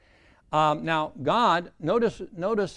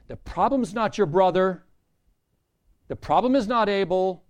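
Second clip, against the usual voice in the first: loud, raised, punched denial; preacher's raised-voice rebuke; forceful confrontation; 3 semitones higher, 7 dB louder than usual. Acted. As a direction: drive it home loudly, pitched up. Preacher voices God confronting Cain.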